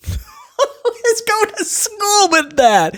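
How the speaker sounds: high, slow, laughing sarcasm; amused scorn. Real, not acted. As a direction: laugh through the mockery.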